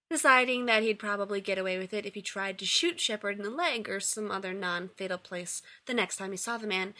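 ordinary delivery

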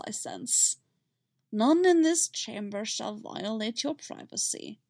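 slow Yoda creak. Acted. Yoda reassuring Shmi about privacy, slow. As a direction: wise old master, amused.

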